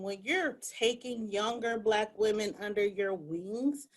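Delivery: normal talking voice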